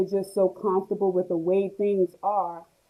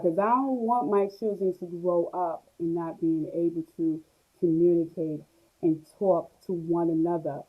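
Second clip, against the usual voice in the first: ordinary delivery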